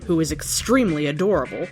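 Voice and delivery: usual speaking voice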